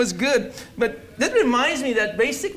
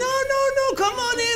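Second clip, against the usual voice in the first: much higher mock-sweet invitation; imitating the dentist lady; much faster than usual. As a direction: squeak it out like the eager dentist.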